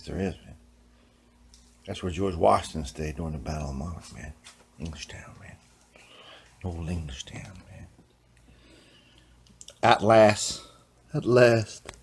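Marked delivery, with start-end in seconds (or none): 4.8-5.6 whisper. Pretend. 6.6-7.9 whisper. Pretend.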